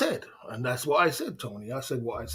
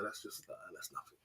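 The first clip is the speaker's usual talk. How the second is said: breathy whispered aside; whispered dismissal; 7 semitones lower, 23 dB quieter than usual. Real, not acted. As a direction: drop to a whisper to brush it off. Trails off into a whisper.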